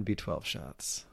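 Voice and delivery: slow, unvoiced whisper. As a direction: breathe it out as a secret. Whispered joke scale.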